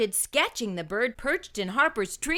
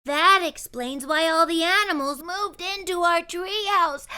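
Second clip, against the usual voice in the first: put-on child voice; 7 semitones higher, 3 dB louder than usual. A narrator's reading. Mona speaking in story.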